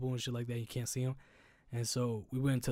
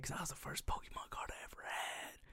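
hushed, mournful confession; whispered sadness; 13 dB quieter than usual. Real, not acted. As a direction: whisper the sad memory. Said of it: "I was so sad".

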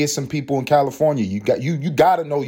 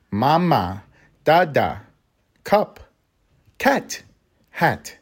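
single words with big gaps, pitched up; imitating toddler-show teacher; 4 semitones higher, much slower than usual. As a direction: teach a baby first words, one at a time. Imitating Miss Rachel's word lesson.

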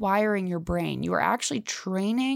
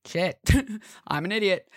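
laughing at self. Retold or imitated. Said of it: "I'm like".

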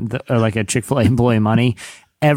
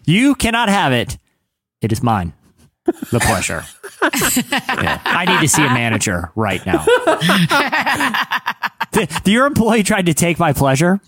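mock entitled customer; 5 semitones higher than usual. Mock customer demanding manager.

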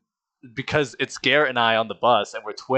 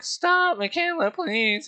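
high-pitched mock whine; squeaky, put-on begging; pleading bullied kid; slower than usual. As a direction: whimper it like a picked-on little kid.

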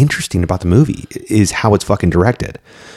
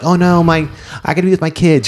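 higher-pitched mock fretting; mock worried mother; 7 semitones higher than usual. Fan voices the witch longing for her kids, mock worry. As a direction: lightly imitate a mother's panic in a higher voice.

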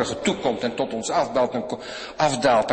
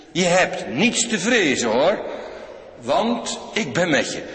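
booming promise; 6 semitones higher, 5 dB louder, slower than usual. Acted. Belofte van God, krachtig.

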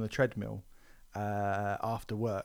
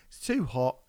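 normal talking voice

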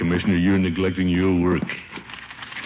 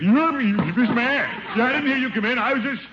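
startled; 12 semitones higher than usual. Acted. Startled awake at his desk.

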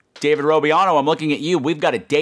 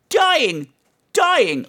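mock dying; 12 semitones higher than usual. Host mocking eager listeners with a high wail.

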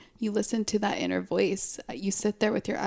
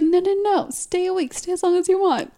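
quoting friend; 9 semitones higher, 4 dB louder than usual. Retold or imitated. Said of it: "You're like".

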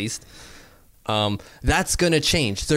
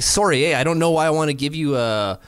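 hammed-up Canadian, pitched up at the start; mock Canadian accent; 3 semitones higher than usual. Canadian executive apologizing to a player over money, in a mock Canadian accent. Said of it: "He's Canadian".

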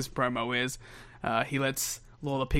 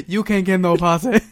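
loud, higher mock Dusty; hammed-up southern wrestler line; Dusty impression; 5 semitones higher, 10 dB louder than usual. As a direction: belt it out like Dusty. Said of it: "he's like".

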